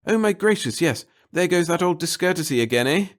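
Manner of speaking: high, loud, fretful little man; fussy nervous clerk. A narrator's reading. The little clerk fretting to the reporter about his boss, high and anxious. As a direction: babble anxiously to please the reporter. Pettigill flustered.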